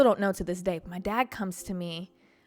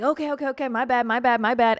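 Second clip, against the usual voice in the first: raised, repetitive backing-off; hasty apology; 5 semitones higher, 7 dB louder than usual. Retold or imitated. Man retreating when others look over, hurriedly apologetic. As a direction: mimic someone apologizing his way out the door.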